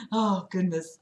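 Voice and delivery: ordinary talk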